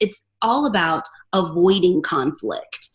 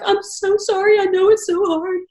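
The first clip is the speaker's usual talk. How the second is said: higher, imitating her own tearful sympathy; retold sobbing condolence; 11 semitones higher than usual. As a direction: replay the teary apology, half-mock. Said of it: "I am sobbing in her arms".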